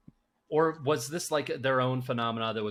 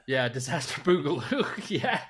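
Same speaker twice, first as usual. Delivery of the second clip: laughing through the words; 4 semitones higher, 4 dB louder than usual. Real, not acted. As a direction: chuckle the silly title out. Joking about sequel title.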